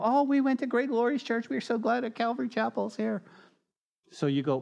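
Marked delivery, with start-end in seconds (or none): 0.0-3.2 higher, gushing newcomer voice; gushing visitor. Retold or imitated. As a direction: gush like a delighted visitor. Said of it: "come in here and go".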